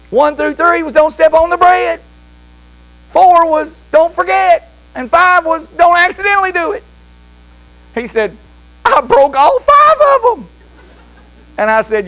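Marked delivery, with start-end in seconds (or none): 0.1-6.8 raised pitch, insistent recitation; exasperated father. Retold or imitated. Father telling boy he broke every rule, exasperated. 8.9-10.5 very high mock wail; screaming child. Small boy admitting all five broken rules, wailed. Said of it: "he started screaming".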